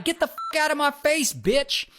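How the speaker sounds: high mock yell without real volume; retold yelling. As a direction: imitate someone in a heated quarrel. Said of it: "these two start yelling at each other".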